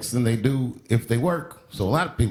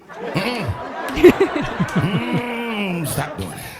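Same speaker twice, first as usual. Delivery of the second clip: disapproving onlooker; 5 semitones higher, 4 dB louder, much slower than usual. Acted. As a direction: play an onlooker tutting at bad dancing.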